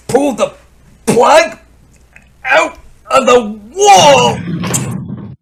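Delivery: high, loud, drawn-out gloat; gloating triumph. Acted. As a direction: savour the victory slowly. Crook gloating over his plan.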